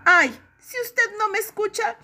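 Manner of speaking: pleading. Acted. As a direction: beg the king on her knees. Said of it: "suplicó con las manos juntas". (madre suplicando al rey de los topos)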